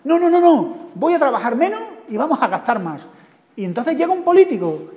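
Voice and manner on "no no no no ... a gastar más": stubborn insisting husband. Acted.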